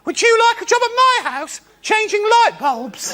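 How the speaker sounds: put-on very high falsetto old-lady voice; hammed-up high old-woman impression; earnest old woman. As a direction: imitate a little old woman asking in earnest. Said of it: "with no hint of irony".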